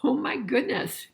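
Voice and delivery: plain reading voice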